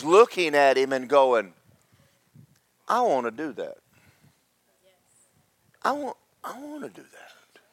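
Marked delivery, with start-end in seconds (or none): 5.8-7.6 hushed, eager whisper; awed whisper. Retold or imitated. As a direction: whisper it like a secret longing. Peter voices his wish in awe.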